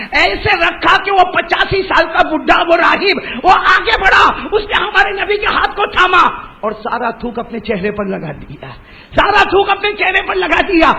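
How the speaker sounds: high, loud, ringing sermon climax; preaching at full force. Acted. Preacher cries out the monk's gesture to the crowd. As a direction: lift the crowd with the miracle.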